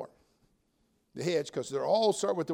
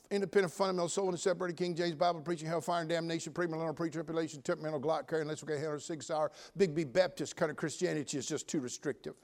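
racing monotone litany of church labels; rapid-fire list; 4 dB quieter than usual. Acted. Preacher mimicking critics of his church, rattled off fast. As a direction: rattle off a long string of labels, quick and even.

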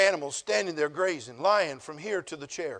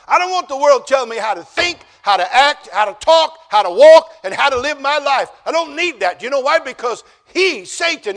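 louder and higher, hammering each phrase; full-force preaching; 6 semitones higher, 12 dB louder than usual. Acted. Pastor warns the church against the world, with fiery preaching. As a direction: thunder it at the congregation.

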